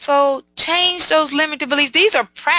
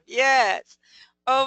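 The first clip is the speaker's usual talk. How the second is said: ordinary delivery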